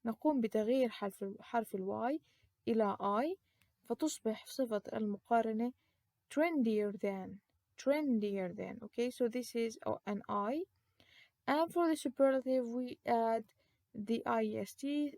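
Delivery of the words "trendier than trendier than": slow model reading. Shown as a demonstration. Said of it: "teacher models comparative twice".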